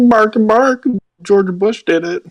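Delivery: high squeaky pet voice; dog voice. Pretend. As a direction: talk like the dog answering. Voicing the dog Daisy.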